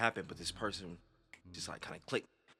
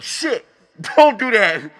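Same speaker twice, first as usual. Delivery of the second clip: startled laugh; 10 semitones higher, 16 dB louder, slower than usual. Real, not acted.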